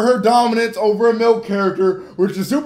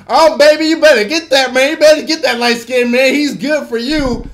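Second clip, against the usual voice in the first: Madea; 3 semitones higher, 4 dB louder than usual. Acted. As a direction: coax them like a pushy older auntie. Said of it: "Madea's like".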